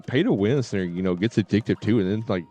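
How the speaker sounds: ordinary delivery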